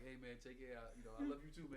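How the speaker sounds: hushed, breathy whisper; whispered goodbye. Retold or imitated. Saying goodbye to his teacher, whispered. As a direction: whisper it like a secret farewell.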